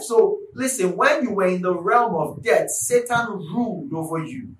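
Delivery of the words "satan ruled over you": usual speaking voice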